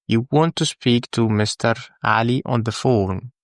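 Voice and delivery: ordinary talk, nothing marked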